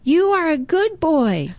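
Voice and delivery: put-on high, cheerful boy calling a compliment; friendly call. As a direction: call out kindly into the woods. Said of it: "and he says".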